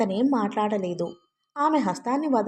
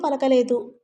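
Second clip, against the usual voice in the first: ordinary delivery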